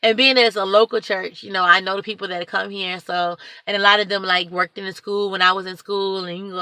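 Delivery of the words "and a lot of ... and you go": ordinary delivery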